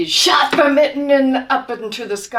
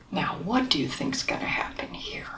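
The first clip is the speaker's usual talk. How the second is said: put-on soft, hushed aside to the kids; whispered question; 6 semitones lower, 11 dB quieter, much faster than usual. Whispered aside to child listeners.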